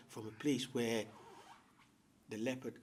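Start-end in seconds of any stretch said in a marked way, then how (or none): none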